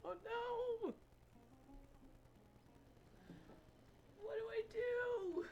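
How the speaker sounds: high, soft panicked squeak; real panic. Real, not acted. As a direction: squeak it out, truly spooked by a spider. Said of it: "I'm freaking out".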